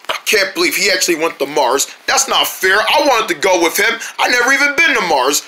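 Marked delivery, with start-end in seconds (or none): none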